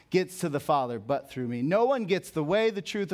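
ordinary delivery

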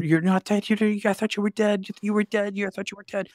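high, frantic mock scream; retold yelling. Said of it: "he's yelling at me and screaming".

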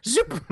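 quick high "zoop" sound effect; playful cartoon zoop; imitated whoosh. Shown as a demonstration. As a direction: zip it out like something sliding into place. Imitates token dropping onto map.

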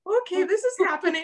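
high, fast, frightened squeak; a retold, hammed-up startled panic; stunned panic. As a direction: blurt it out in shocked disbelief. Retold panic at child ghost.

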